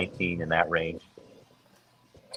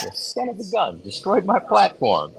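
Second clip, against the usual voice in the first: high-pitched comic lament; hammed-up, high-voiced grievance; mock exasperation; 11 semitones higher than usual. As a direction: act out joking outrage, drawing out the end. Joking retold outrage at divorce.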